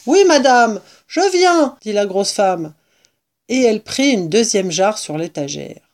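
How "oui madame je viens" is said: high, loud reply; calling up. A narrator's reading. Woman answers impatient mistress, calling up. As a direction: holler that you are on your way.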